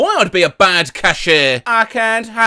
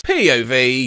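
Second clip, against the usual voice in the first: normal talking voice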